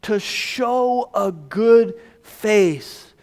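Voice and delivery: very slow, weighted word by word; slow literal rendering. Shown as a demonstration. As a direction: draw out each word for the congregation. Literal translation repeated slowly.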